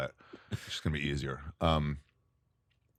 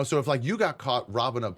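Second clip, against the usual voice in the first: usual speaking voice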